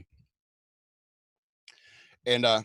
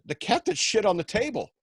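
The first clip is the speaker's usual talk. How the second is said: innocent explanation; 9 semitones higher than usual. Retold or imitated. Naively explains the cat turd.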